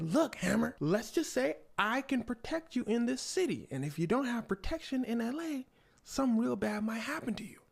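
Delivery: high-pitched salesman-like menace; veiled threat. Acted. Michael pitching protection to Hammer, lightly menacing. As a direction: lean on the warning, mock intimidation.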